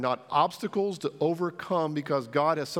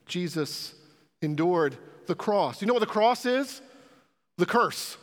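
usual speaking voice

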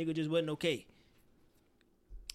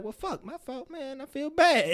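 high, mock deflated apology that swells louder at the end; imitating guilty friend; 9 semitones higher, 5 dB louder, slower than usual. Friend hearing always-and-nevers, mock guilty. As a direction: play the scolded friend sinking. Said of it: "Person just gonna be like".

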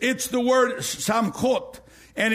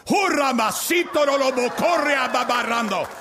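speaking in tongues; 4 semitones higher than usual. Retold or imitated. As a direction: rattle off ecstatic tongues. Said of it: "I began to speak in other tongues".

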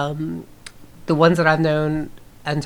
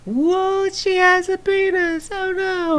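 high, wailing goofy man voice; mock horror; slower than usual. Acting out the comedy hero's discovery, high mock-horrified wail. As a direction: wail it slowly in a silly high pitch. Mocking movie man's shocked discovery.

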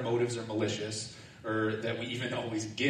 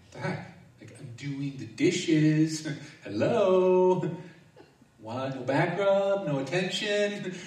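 mock frustration; 6 semitones higher, 6 dB louder, slower than usual. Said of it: "I was so frustrated".

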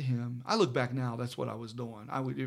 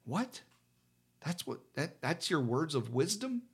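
incredulous reaction; 2 semitones higher than usual. Retold or imitated. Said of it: "first thought came to mind".

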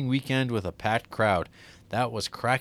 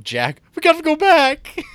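high, loud mock Kate voice; 8 dB louder than usual. Podcast host parodies Kate's famous line to Jack with a high, loud mock voice. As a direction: squeak it out in a hammed-up Kate impression.

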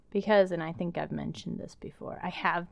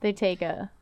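ordinary delivery

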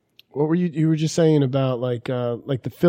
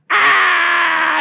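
long high, loud "aah" scream; sustained mock screech; mock shriek; 13 semitones higher, 5 dB louder than usual. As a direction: imitate a piercing shriek, held out. Imitates wife's outburst.

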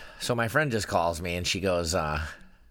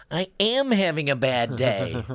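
imitating an upset kid, high and slow with AM leaned on; mock-tearful, drawn-out protest from a little boy; teary child; 3 semitones higher, 4 dB louder, slower than usual. Seven-year-old son answering his mother, teary protest. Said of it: "he got a little bit teary-eyed".

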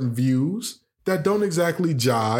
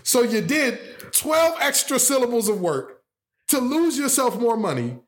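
mocking; 8 semitones higher than usual. Real, not acted. Mocking punchline, high pitch.

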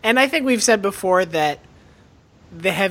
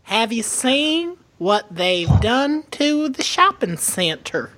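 drawn-out, hammed-up Southern whine; whiny Southern accent; 5 semitones higher than usual. Mock local griping about the shopping center, whiny Southern accent. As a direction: complain in a mock Southern drawl, rising high. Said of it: "that specific brand of Southern accent".

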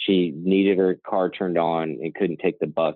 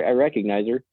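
ordinary talk

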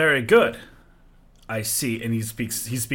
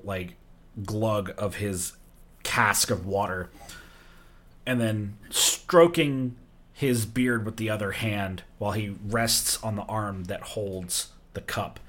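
ordinary talk

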